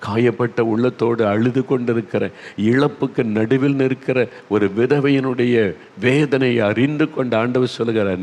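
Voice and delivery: usual speaking voice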